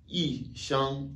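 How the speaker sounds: "i shang" with "i" falling sharply from high; fourth-tone yi. Shown as a demonstration. Teacher shows learners yi before first tone, falling "i" in "i shang". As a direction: say "i shang", dropping the tone on "i". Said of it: "le i devient automatiquement quatrième ton".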